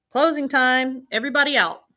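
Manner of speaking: calling out. A narrator's reading. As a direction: call everyone out at closing. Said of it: "the manager of the laundromat called".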